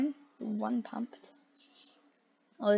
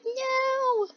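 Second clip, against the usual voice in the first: very high, long drawn-out squeal; mock protest. Viewer voicing guy trying to get out, high mock wail. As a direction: hold a squeaky high note in mock dismay. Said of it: "He's like".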